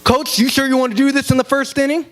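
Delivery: a hint of a threatening umpire, pitched high; intimidating umpire. Umpire trying to intimidate the coach, a mild mock threat. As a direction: lean in and dare the coach. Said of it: "wants to start getting intimidating".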